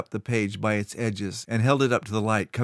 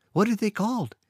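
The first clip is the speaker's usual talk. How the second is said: put-on much higher, excited child; eager boy; 12 semitones higher than usual. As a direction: lean in eagerly.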